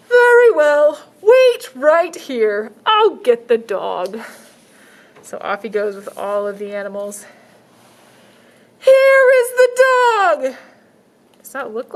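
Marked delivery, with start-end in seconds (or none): 0.1-4.2 high, slow salesman pitch; shelter man voice. Acted. 8.8-10.6 high, slow salesman pitch; shelter man voice. Acted.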